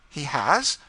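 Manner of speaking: high, loud, startled question; urgent high-pitched exclamation; quick, eager response. A narrator's reading. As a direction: burst out with sudden hope. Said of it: "said Madame de Thou quickly".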